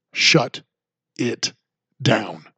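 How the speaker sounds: each word spaced out, falling; slow emphatic. Real, not acted. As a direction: lay out shut, it, down one at a time. Predicting the shutdown.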